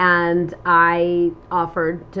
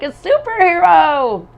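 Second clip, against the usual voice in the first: emphatic; 9 semitones higher, 9 dB louder, slower than usual. Real, not acted. Exaggerating superhero ideal.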